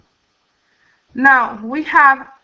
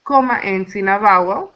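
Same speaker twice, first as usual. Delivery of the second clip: ordinary talk, nothing marked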